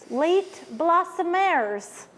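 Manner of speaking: drawn out and slow, like naming a band; slow band-name announce. Shown as a demonstration. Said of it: "It's a band".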